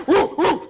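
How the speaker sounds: high, whooping dog woofs; imitated dog bark. Dog chasing the monkey in the field, imitated barking. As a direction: woof like an excited dog.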